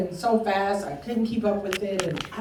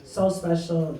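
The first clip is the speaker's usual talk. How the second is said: ordinary talk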